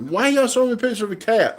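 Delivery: high-pitched, baffled protest; exasperated. Real, not acted. Dad shown a cat picture mid-video, exasperated protest. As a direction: pitch it way up in disbelief. Family pesters him about a cat.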